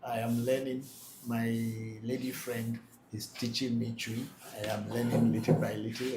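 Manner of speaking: ordinary talk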